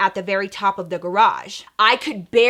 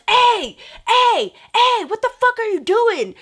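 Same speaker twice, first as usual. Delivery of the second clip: high, loud retold yell; calling out in alarm; 12 semitones higher, 4 dB louder than usual. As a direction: yell a sharp challenge across to someone.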